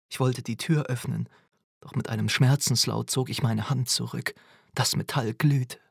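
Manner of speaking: plain reading voice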